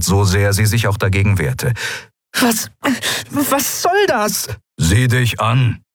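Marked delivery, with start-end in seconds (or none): 2.4-4.5 very high, drawn-out indignant question; indignant protest. A narrator's reading. Pilot being pushed from her console, indignant. 4.8-5.8 slow, understated grumble; grumbling. A narrator's reading. Gruff friend telling exhausted pilot to look at herself, grumbling. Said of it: "brummte er".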